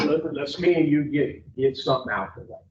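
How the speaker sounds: normal talking voice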